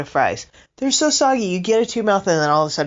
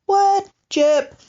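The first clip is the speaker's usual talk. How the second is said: high, quick indignant cat; cat voice, surprised; 9 semitones higher than usual. Retold or imitated. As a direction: protest like a cheated cat, fast and high. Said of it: "And she's like".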